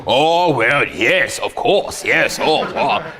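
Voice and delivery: put-on delighted cabbie, drawn-out exclamations; taxi guy. Taxi guy answering about tips, put-on voice. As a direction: stretch each word in a put-on cabbie voice. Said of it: "the taxi guy always goes".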